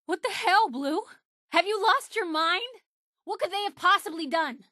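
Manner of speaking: high, alarmed disbelief at partner; shocked outrage. Acted. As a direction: recoil in shock at what he did. Partner attacks innocent people.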